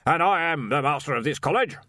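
loud, high, blustering archchancellor; blustery old wizard. Acted. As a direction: demand it like a bluff old master.

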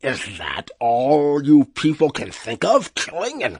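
slow creature voice, climbing high at the end; dismayed talking wub. Acted. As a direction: rebuke them as an appalled beast. Dismayed wub rebukes the crew.

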